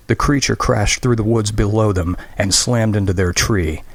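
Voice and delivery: ordinary delivery, nothing marked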